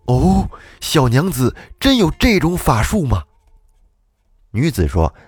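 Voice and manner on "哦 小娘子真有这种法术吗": pitched up with sudden hopeful delight; sorrow turned to joy. Acted. Old gardener hearing of the magic, suddenly hopeful and eager. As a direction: brighten suddenly out of grief. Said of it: "转而化悲为喜地说".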